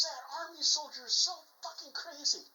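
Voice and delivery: acted panic, pitched high; mock-frantic, high-voiced complaint; freaking out. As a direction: blurt it out as a rattled soldier. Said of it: "the Russian soldier is freaking out".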